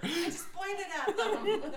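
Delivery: high, loud, slow laughing protest; exasperated Brian. Retold or imitated. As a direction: insist you only pointed.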